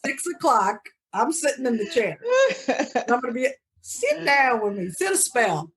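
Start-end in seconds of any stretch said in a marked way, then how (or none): 3.8-5.7 lightly voiced Southern lady, slower and higher; a hint of an old Southern porch invitation, slow; Southern accent. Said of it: "I gotta get that Southern accent".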